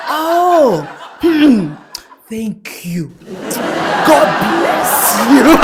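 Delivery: imitated mom bestowing a blessing; high mock-mother voice; grateful mother. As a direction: bless the informant, slowing at the end. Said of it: "So my mom would be like".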